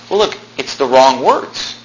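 ordinary delivery

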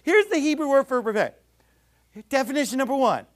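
high, loud, exaggerated explaining-to-toddlers voice; Jim Shetler. Mocking a colleague defining a Hebrew word, hammed-up toddler-teacher voice. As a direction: spell it out as if to little children, mockingly. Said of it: "sound like he's talking to toddlers".

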